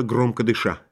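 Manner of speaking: ordinary delivery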